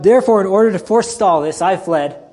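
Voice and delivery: indignant Jonah. Retold or imitated. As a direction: blurt it out as a defiant excuse. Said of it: "He says".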